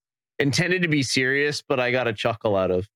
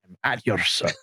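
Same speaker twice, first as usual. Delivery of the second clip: lightly mimicked movie catchphrase; imitating the film line; 4 semitones lower than usual. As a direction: relish it like the movie character's greeting. Savours and mimics film phrase.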